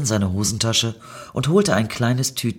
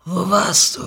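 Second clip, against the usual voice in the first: höhere Stimme eines mitgenommenen Dealers; abgewrackter Dealer; 9 semitones higher, 4 dB louder than usual. A narrator's reading. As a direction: frag wie ein alter, verlebter Dealer. Abgewrackter Dealer fragt Niki aus. (abgewrackter Dealer, hohe Stimme)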